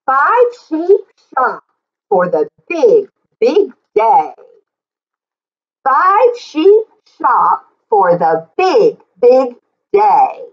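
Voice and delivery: rhythmic read-aloud, leaning on each sheep; reading to children. Acted. Teacher reading the book aloud to the class, in a lively sing-song. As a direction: read it out like a picture book for little kids.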